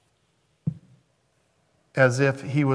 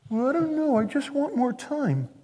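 high, soft hesitant follower; mock reluctant stalling; 10 semitones higher, 4 dB quieter than usual. Preacher voicing someone putting off Jesus's invitation, mock reluctance. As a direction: beg off in a small, pitched-up voice.

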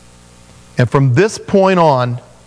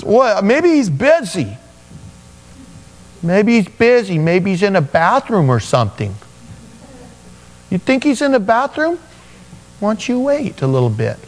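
higher, teasing sarcastic jab; mocking taunt; 6 semitones higher than usual. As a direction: needle the false prophets with mock concern. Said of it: "Elijah now is starting to make fun of them".

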